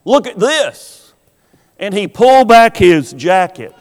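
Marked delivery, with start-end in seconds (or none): 0.0-1.1 raised, higher voice of a bully calling; bully's taunting call-out. Retold or imitated. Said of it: "That boy called out".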